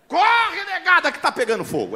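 grito alto e agudo de alarme; grito de alerta. Retold or imitated. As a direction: gritar como quem avisa de incêndio. Gritando alerta de incêndio. (grito de alarme: a casa pegando fogo)

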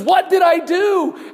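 high, loud, slow lament; anguished cry. Retold or imitated. Widow realising her mistake, crying out high and loud. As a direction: cry out in regret. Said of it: "she says".

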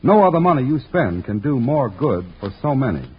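ordinary delivery

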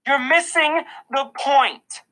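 high, slow exasperated yell. A narrator's reading. Mom to son in principal's office, yelling. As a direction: yell it, stretching the words. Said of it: "Mom yelled".

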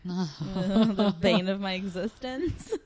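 slow, laughing lament. Real, not acted. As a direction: laugh through a mock groan. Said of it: "laughing mock lament".

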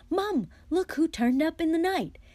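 high, loud, excited call; mock surprise. Fred acts out Ron's call to Mum, excited. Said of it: "you come bounding downstairs going".

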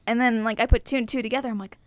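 normal talking voice

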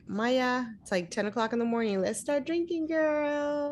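soft, then high sing-out to a pal; mock dance-mom coaxing, ending high; playful dance mom. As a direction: coax a friend into drinking, pitch climbing. Plays her future dance-mom self.

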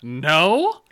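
high, loud baffled huh, no; incredulous huh. Retold or imitated. Retelling rejecting coworker's friendship, incredulous high no. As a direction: act out total confusion. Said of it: "I looked at him, I was like".

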